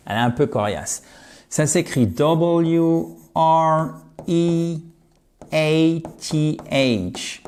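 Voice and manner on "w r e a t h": very slow, one letter at a time; spelling out. Shown as a demonstration. Teacher spelling a tricky word, letter by letter. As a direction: spell W R E A T H slowly, stretching each letter. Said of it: "je vous l'épelle".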